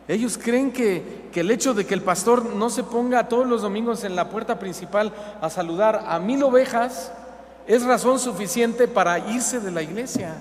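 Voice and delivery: full-force pulpit preaching; fervent preaching. Acted. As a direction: preach it with rising heat.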